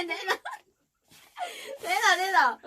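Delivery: loud, high "give it back"; demanding shout. Real, not acted. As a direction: yell for the food back. Grabbing food, demanding.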